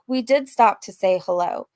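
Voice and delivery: ordinary talk, nothing marked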